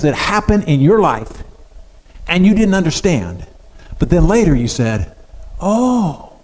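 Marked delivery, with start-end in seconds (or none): 5.6-6.3 high, drawn-out "oh" of understanding; acted moment of realization; dawning realization. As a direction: let the penny drop, pitch swooping up. Acting out a later realization.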